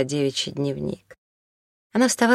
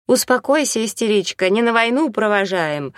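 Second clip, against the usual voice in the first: higher, louder, scolding snap; sharp rebuke; 6 semitones higher, 3 dB louder than usual. Acted. Елена Ивановна scolding the weeping Наталья, a sharp rebuke. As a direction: cut her short, sternly. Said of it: "одёрнула её Елена Ивановна".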